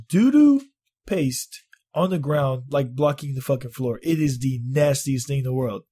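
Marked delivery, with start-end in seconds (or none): 0.1-1.6 squeaky doo-doo. Real, not acted. Telling coworkers about the mess, high squeaky doo-doo. Disgusted storytelling about poop.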